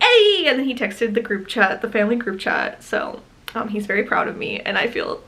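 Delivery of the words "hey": high, louder mock-excited hey; imitating partner's eager greeting; retelling partner's thrilled text about an order. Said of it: "he texted me immediately, like".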